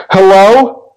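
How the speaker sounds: put-on timid girl calling out, pitched well up; high shaky call. A narrator's reading. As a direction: call out high and nervous through the door. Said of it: "in a high, shaky voice".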